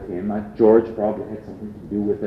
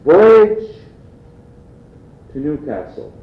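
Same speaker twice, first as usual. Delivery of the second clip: hammed-up old ad read, high and loud openings, long pauses; newspaper ad pitch; 4 semitones higher, 6 dB louder than usual. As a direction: proclaim each selling point, then leave it hanging. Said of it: "these newspaper ads that said".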